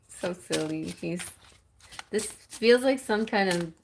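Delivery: usual speaking voice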